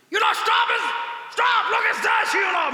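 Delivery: loud, high shout of a foreign tourist; hammed-up foreign friend shouting stop; excited foreigner. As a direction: yell it out like an excited foreign visitor.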